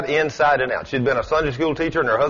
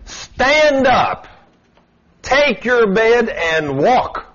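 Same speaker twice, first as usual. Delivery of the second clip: higher, loud command, slowing on take your bed; commanding shout; 7 semitones higher, 3 dB louder, much slower than usual. Retold or imitated. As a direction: order the man up with full authority. Jesus commanding the sick man.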